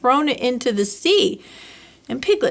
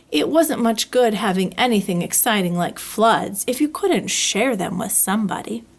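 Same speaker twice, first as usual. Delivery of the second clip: ordinary delivery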